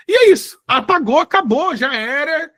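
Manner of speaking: a burst of alarmed emphasis to the audience; emphatic outburst. Real, not acted. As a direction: declare it's all gone, gone for good. Dramatic end of accents.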